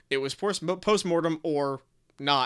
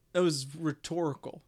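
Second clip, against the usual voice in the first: ordinary delivery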